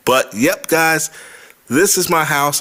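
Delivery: bright and eager reveal of the new house; light rising excitement, proud to show it off; happy reveal to viewers; excited. Real, not acted. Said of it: "I'm very excited".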